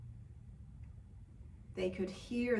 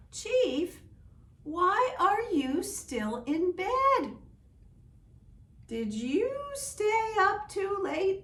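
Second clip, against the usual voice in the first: put-on much higher, louder, slow childlike voice; child voices; 11 semitones higher, 7 dB louder, slower than usual. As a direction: sound like a curious little child. Children question the chief in bed.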